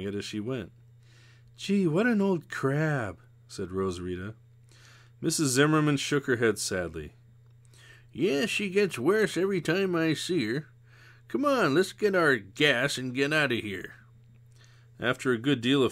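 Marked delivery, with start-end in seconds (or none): 1.6-3.2 slow, lightly voiced girl, higher; a touch of a kid grumbling; mildly voiced girl scorn; young girl Rose Rita. A narrator's reading. 8.1-13.9 lightly voiced older woman, higher; a hint of a weary old friend; mild tired sigh of a voice; Missus Zimmerman. A narrator's reading.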